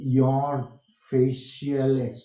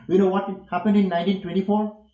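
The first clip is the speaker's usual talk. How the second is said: louder, higher, quicker question on "happened in nineteen twenty-four"; question intonation; 5 semitones higher, 5 dB louder, much faster than usual. Shown as a demonstration. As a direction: ask "Do you know what happened" as a real question. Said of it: "ask it as a question".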